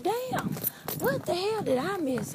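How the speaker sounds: high, hushed disbelief; stunned muttering to oneself, high-voiced; taken aback. Reacting to everyone's dressy clothes.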